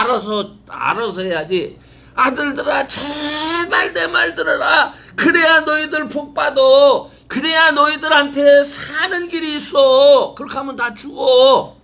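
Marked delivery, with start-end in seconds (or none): 2.1-11.7 high, loud, tearful pleading rising to a shout; plead, then cry out the warning; a father pleading with his sons, near tears; pleading wise father. Acted. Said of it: "지혜자는 뭐라고 말하느냐".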